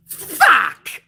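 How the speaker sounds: sharp, high, loud curse; frustrated outburst. Real, not acted. Vlogger reacting to the botched finish, frustrated outburst. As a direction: blurt it out in disbelief. Lights up, Bray gone.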